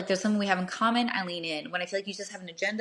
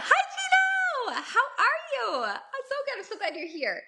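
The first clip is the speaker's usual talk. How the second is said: much higher, laughing hello to a friend; light up and laugh as he walks in; delighted laughing welcome to Gino as he arrives; excited greeting; slower than usual. Real, not acted. Greets Gino arriving mid-talk.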